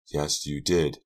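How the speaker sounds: ordinary delivery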